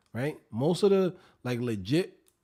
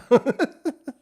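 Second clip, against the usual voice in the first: loud high laugh; 10 semitones higher, 9 dB louder than usual. Real, not acted. Laughing at own joke.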